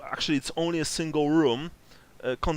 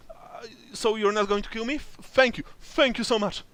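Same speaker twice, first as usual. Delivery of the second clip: terrified; 7 semitones higher than usual. Acted. Spared NPC thanking them.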